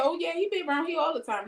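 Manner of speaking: bright nosy neighbor spilling the news; eager neighbor. Retold or imitated. Neighbor tells the wife he's always around, eager and high.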